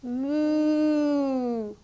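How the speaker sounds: slow playful moo; cow moo. Shown as a demonstration. Teacher mooing like a cow for a child in cat-cow.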